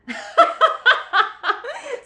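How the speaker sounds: high, loud burst of laughter; a loud rising laugh; big laugh. Real, not acted. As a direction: crack up at the joke. Cohost mocks a bad character.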